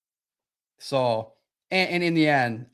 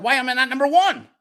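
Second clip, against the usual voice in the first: mock indignation; 10 semitones higher, 4 dB louder than usual. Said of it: "'cause I'm like".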